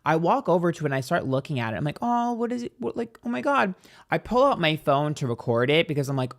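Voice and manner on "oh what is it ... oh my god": higher, wondering aloud; curious surprise. Retold or imitated. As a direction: peer at it, puzzled and amazed. Curious at the bird.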